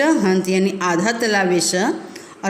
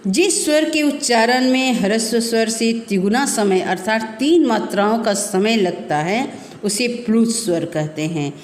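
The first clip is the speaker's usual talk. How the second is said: ordinary delivery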